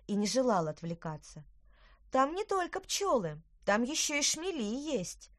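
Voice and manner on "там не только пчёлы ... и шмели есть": put-on high, eager little girl. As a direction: pipe up like a young girl. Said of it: "поделилась своими наблюдениями Лина".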